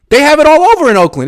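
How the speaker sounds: usual speaking voice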